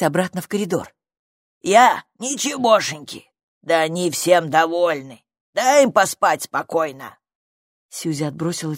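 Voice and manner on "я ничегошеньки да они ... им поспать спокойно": gruff creature defending himself; grumpy little creature. A narrator's reading. Stranger denies harming the parents, grumpy protest. Creature answers the girl's accusation.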